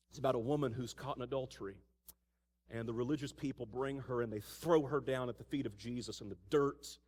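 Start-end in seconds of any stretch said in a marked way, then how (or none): none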